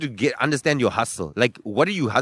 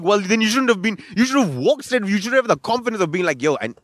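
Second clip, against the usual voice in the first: mock lecture; 8 semitones higher than usual. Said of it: "And then I was like".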